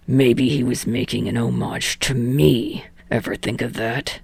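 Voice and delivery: defiant retort. Acted. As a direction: push back, voice dropping away.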